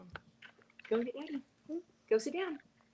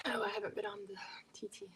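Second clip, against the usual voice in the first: whisper; 16 dB quieter, much faster than usual. Real, not acted. Whispered aside.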